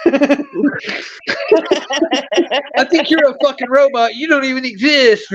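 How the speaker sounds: imitating a friend snapping insults; retold angry yell. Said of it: "and he's like".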